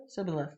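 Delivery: "sibboleth" with plain s, said softly; Ephraimite mispronunciation. Shown as a demonstration. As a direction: say "sibboleth" with s in place of sh. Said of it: "They could only say s".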